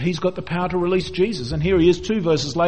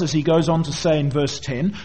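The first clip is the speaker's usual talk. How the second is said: ordinary delivery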